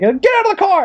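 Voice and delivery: high, loud barked order; police yelling. Acted. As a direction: yell it like an arresting cop. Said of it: "like, yelling at him".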